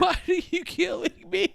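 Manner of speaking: high, drawn-out wail in imitation; exaggerated, slow, high-pitched mock sob; mock crying plea. Host imitating wrestler kicked by Xia Li, mock crying plea. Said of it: "crying, and he's like".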